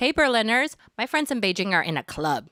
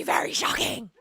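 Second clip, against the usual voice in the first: unvoiced whisper of very shocking; mock hushed shocking. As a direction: whisper it like a secret.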